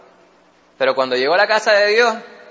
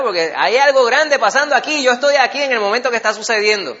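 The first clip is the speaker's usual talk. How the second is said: higher and louder, thrilled, with a laugh; excited witness; 6 semitones higher, 5 dB louder than usual. Pretend. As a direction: voice someone amazed as it happens. (emocionado, más agudo y fuerte, con una risa)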